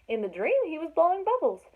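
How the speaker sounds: ordinary delivery, nothing marked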